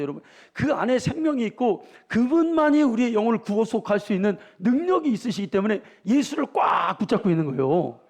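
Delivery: high, loud revival preaching; full-force preaching. Acted. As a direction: hammer Jesus home to the church.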